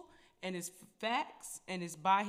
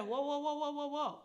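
higher, calming whoa, whoa; Jesus stopping him; 6 semitones higher than usual. Retold or imitated. Jesus halting his disciple, higher and firm. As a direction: rein the disciple in with a raised voice. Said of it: "And Jesus said".

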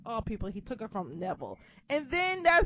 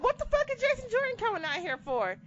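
disbelief; 12 semitones higher than usual. Retold or imitated. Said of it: "I'm like".

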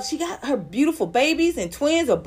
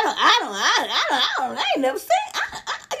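laughing; 3 semitones higher than usual. Real, not acted. Laughing in disbelief.

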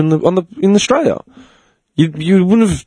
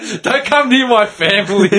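raised voice collapsing into laughter; laughing mock warning; 8 semitones higher than usual. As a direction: play the warning, crack up midway. Acted quote breaking into laughter.

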